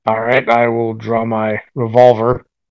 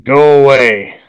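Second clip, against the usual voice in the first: loud, raised snarl at the door; snarled warning; 4 semitones higher, 10 dB louder, slower than usual. Acted. Vampire warns off whoever is hammering at the door, loud snarl. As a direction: snarl it out loudly to scare them off. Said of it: "snarl in a very unnaturally deep voice".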